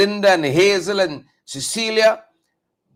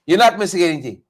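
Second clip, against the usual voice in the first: ordinary talk, nothing marked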